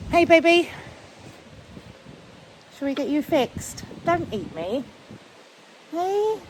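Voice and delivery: high, sing-song pet voice. Real, not acted. Talking to her horse.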